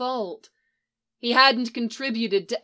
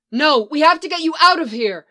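high loud urgent cry; loud, higher pleading cry; cried out; 6 semitones higher, 9 dB louder than usual. Acted. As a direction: shout it in desperation. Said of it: "she cried".

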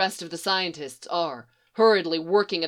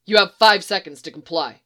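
loud, cold order; hard ultimatum; 8 dB louder than usual. Acted. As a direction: lay down the deadline. Trooper gives an ultimatum.

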